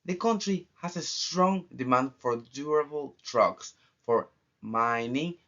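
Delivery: usual speaking voice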